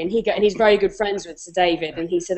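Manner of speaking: ordinary talk, nothing marked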